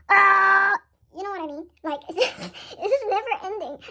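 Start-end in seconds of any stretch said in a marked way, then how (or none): 0.1-0.7 frustrated shout. Real, not acted. Venting frustration about money. 1.8-3.8 laughing. Real, not acted. Laughing about endless stress.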